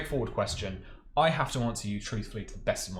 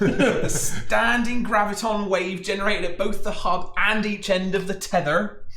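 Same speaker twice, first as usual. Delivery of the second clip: high, loud, slow West Country reading; West Country accent; 7 semitones higher, 5 dB louder, slower than usual. Acted. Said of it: "some West Country accent".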